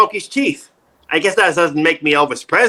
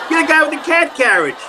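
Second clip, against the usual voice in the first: yelled out; 9 semitones higher than usual. Retold or imitated. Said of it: "a guy yells out to me".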